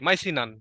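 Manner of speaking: "মাইসিনান" run together as mai-si-nan; simplified pronunciation. Shown as a demonstration. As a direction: say "মাইসিনান" in one go, no "-iyan" ending. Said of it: "বা এরকম কিছু একটা আর কি". ("মাইসিনান" একটানে সহজ উচ্চারণ)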